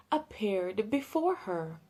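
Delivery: plain reading voice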